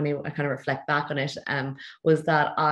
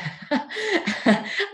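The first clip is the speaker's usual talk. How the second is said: a bright, high burst of real laughter. Real, not acted. Guest laughing at her old plan to wear suits.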